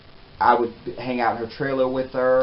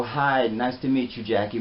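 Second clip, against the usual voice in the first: ordinary talk, nothing marked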